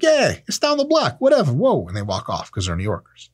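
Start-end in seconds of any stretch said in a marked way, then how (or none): 0.0-1.9 much higher, offhand brush-off; dismissive New Yorker. Retold or imitated. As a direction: toss off directions without caring. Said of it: "And like".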